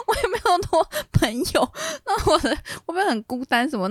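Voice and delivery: high, loud mock sob; mock crying. Recalling funeral tears to a friend, high mock sobbing. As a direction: whimper like a self-pitying teenager. Acts out her tearful younger self.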